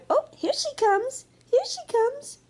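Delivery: put-on high excited baby voice; baby walrus excited. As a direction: squeal it as the baby spots mama. Baby walrus sees mama.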